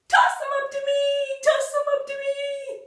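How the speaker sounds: high, loud shouted devil's cry; devil shouting. Acted. The devil on the roof shouting at the robber, high and loud. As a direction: shriek it from the rooftop like a devil. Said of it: "a devil up on top shouting".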